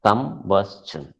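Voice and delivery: combustion split as kum, bus, chun; syllable by syllable. Shown as a demonstration. Teacher showing sound decides the syllable count, syllable by syllable. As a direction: give combustion three separate beats. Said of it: "three syllables".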